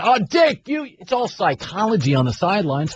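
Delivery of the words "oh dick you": high, chummy plea to the ref; lightly voiced coach buttering up the ref; mock schmoozing coach. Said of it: "He's prod".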